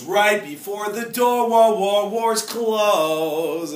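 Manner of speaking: slow, loud, drawn-out suspense; suspense build. Acted. Speaker tells class the elevator doors are closing, slow and loud.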